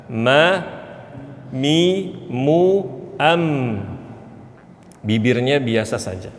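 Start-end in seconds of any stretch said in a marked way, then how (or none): none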